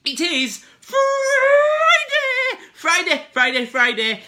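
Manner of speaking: high, loud Friday cheer; excited. Real, not acted. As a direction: sing out the Friday hype.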